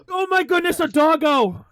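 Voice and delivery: loud, high squeal of delight; gasp, then cry out at the dog; very high, thrilled shout; real excited outburst. Real, not acted. Suddenly sees a dog on camera.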